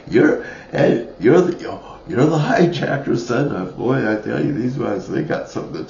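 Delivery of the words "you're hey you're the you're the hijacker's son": acting out onlookers, higher and louder; mock excited call-out, stumbling into it; excited recognition. Park people recognising the son, excited recognition.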